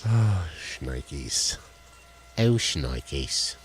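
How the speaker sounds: normal talking voice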